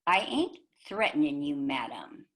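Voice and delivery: normal talking voice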